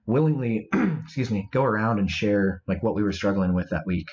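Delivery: clearing throat between words; coughing fit. Real, not acted. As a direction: keep clearing the throat mid-sentence. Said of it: "not sure why I'm coughing over here".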